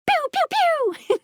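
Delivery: high, drawn-out toy laser "pew"; make-believe ray-gun sounds, high and slow; playful laser noises. Acted. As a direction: squeal out cartoon beam shots. Imitating beam-shooting sounds.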